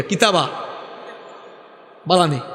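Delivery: imitated Sylheti greeting; Sylheti dialect. Shown as a demonstration. Preacher shows congregation how Sylhet greets, Sylheti dialect. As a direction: greet them as a Sylheti local would. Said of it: "আবার সিলেটে গেলে আপনাকে খুব সুন্দর বলবে".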